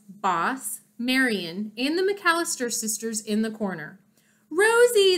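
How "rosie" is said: high, loud happy cry of a name; excited cheer. A narrator's reading. Crowd cheering their friend, excited call. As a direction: cheer her name as she arrives.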